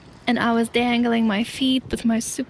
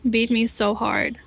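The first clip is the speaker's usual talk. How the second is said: usual speaking voice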